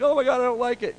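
high, loud mock panic; hammed-up freak-out, pitched high. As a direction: imitate someone getting alarmed. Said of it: "People begin to kind of freak out".

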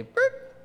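very high, short imitated noise; comic sound of stopping dead; screech-to-a-halt noise. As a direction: squeak out a sudden stop. Imitates men stopping dead, stunned.

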